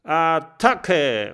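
Attacke! laut und höher aus vielen Kehlen gebrüllt; Attacke! als Schlachtgebrüll der Meute. Acted. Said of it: "brüllen". (Attacke! laut gebrüllt)

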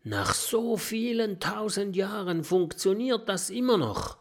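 put-on high-pitched young character voice; amazed remark. A narrator's reading. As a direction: marvel at it in a high voice. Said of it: "bemerkt Oskar".

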